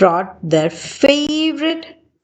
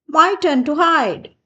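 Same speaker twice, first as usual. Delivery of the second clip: high eager; 7 semitones higher than usual. A narrator's reading. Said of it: "said Sally".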